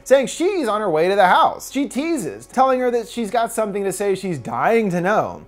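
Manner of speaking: ordinary talk, nothing marked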